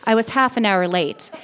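plain reading voice

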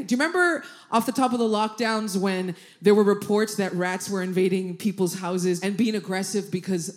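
ordinary talk

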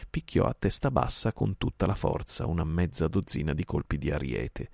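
ordinary delivery

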